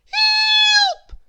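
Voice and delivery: very high, loud squeaky cry for help; tiny shriek. A narrator's reading. Miss Tiny cornered by Ginger, shrieking for help. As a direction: shriek with all her little might. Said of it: "shrieked Miss Tiny at the top of her voice".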